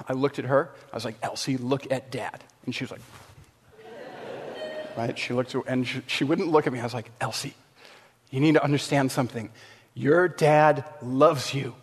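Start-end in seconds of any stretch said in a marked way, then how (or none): 1.2-2.4 gentle fatherly hush. Retold or imitated. Father calming upset little daughter. 7.2-11.8 soft fatherly reassurance. Retold or imitated. Father calming upset little daughter.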